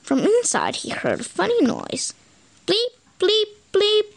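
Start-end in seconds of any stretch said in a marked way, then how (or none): 2.7-4.0 high electronic bleep sounds; computer bleeps. Acted. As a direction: bleep like a game console. Computer noise in story.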